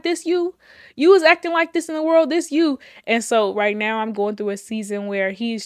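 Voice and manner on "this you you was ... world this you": higher, louder, fast mocking "this you"; playfully calling her out, fast and loud; mock teasing call-out. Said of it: "He, he like".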